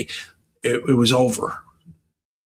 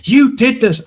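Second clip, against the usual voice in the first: mock accusing teacher; 10 semitones higher, 4 dB louder than usual.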